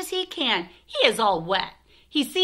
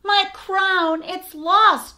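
high, loud cry of alarm; panicked cry; 9 semitones higher, 7 dB louder, much slower than usual. Acted. Babar discovering his crown is lost, crying out high and loud. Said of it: "cries Babar".

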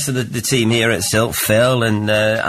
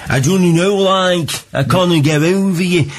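put-on Stoke accent. Radio host mimics the song's singer, Stoke accent. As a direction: do the lyric in a broad Stoke accent. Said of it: "there's a real Stoke accent".

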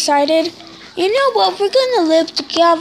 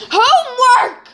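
higher, louder, whiny groan; exasperated shout; 7 semitones higher, 5 dB louder, slower than usual. Real, not acted. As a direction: wail the word in despair. Groaning about homework.

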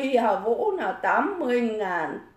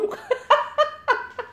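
a real high-pitched laugh breaking out; high burst of laughter. Real, not acted. Laughs again at comment. (tiếng cười phá lên cao giọng)

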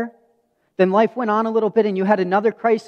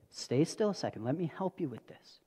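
put-on much softer, gentle reassurance to a child; calming his daughter, soft and tender; 2 semitones lower, 13 dB quieter than usual. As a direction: hush her still like a tender dad. Calming daughter's eye speck.

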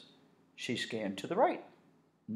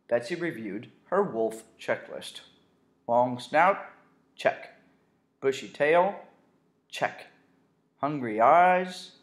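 ordinary delivery, nothing marked

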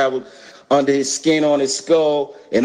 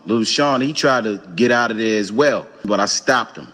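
ordinary talk, nothing marked

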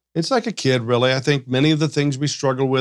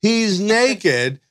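high-pitched imitation of a child's call; child yelling out; 8 semitones higher than usual. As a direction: call it out like a kid. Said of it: "until the child yells out".

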